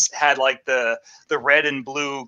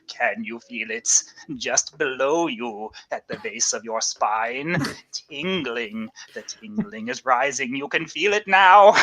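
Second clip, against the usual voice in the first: Vincent Price to audience; 2 semitones lower, slower than usual. Retold or imitated. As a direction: draw the audience in with a creepy hush. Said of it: "sounds like he's talking to the audience".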